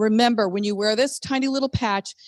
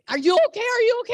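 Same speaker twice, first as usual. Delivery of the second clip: higher-pitched imitation of a worried woman; alarmed questioning; 10 semitones higher, faster than usual. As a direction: retell her alarmed check-in, pitched up. Retells her alarmed questioning.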